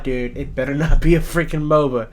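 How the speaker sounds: usual speaking voice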